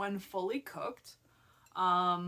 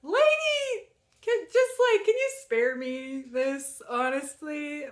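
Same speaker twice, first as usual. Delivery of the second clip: exasperated plea; 7 semitones higher, 8 dB louder than usual. Retold or imitated. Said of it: "I was like".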